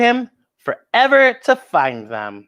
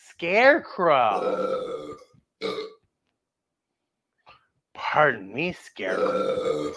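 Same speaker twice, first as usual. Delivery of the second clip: much lower, slow, soft scarecrow voice; a hushed, creepy scarecrow rasping back; 12 semitones lower, 7 dB quieter, much slower than usual. Pretend. Scarecrow answering the storyteller in a low hush.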